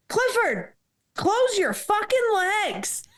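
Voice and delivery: high, slow mock sergeant voice; sergeant's crude order. Sergeant snapping at her in briefing, slow mock order. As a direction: bark it out like the blunt sergeant. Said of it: "and he's like".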